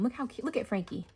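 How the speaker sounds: ordinary talk, nothing marked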